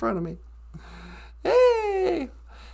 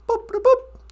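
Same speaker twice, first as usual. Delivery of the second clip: high, chirpy mouth-made boops; computer beeps; 7 semitones higher, 4 dB louder than usual. Pretend. Playing holodeck, imitating computer beeps. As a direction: beep like a sci-fi computer.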